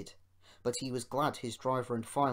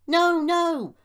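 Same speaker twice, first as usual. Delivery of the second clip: very high, loud wail; drawn-out high cry of dismay; 13 dB louder than usual. A narrator's reading. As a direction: wail it in alarm. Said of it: "wailed the empty trucks".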